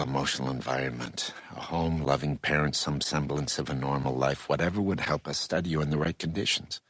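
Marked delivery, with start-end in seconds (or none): none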